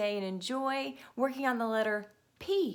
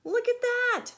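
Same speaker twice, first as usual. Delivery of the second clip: much higher, louder amazed shout; guard's shout; 13 semitones higher, 6 dB louder than usual. Acted. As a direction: call out in amazement. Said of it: "shouted the factory guard".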